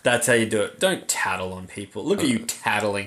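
low, soft mock scolding; condescending. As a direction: talk down to a little tattletale. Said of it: "trying to be condescending to someone".

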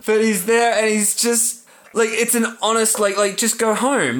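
strained high pitch, still upset; tearful. Real, not acted. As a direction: carry on the story while recovering from real crying. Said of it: "funny to watch you cry".

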